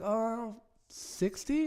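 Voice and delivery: rising high mock surprise; surprised high. Friend quoted asking her age, high and surprised. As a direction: squeak it out like a shocked friend. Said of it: "He's like".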